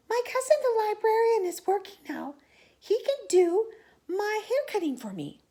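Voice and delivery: high, light voice, whispered aside; hairdresser voice. Acted. Hairdresser tells friends his plan in a high voice. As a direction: chirp out the plan.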